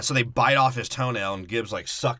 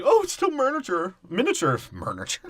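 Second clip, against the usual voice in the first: high, whiny squeal of dismay; mock whiny Jack; 9 semitones higher than usual. Podcaster mocking Jack over the tiny ship, whiny squeal. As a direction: wail it like a spoiled pirate.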